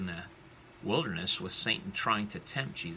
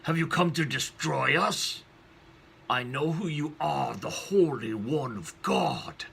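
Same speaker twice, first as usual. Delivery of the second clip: evil spirit, raised then drawn out slowly; demonic voice; 4 semitones higher, 4 dB louder than usual. Acted. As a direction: snarl it as a threatened demon, slowing at the end. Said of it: "terrifying voice that sounds demonic".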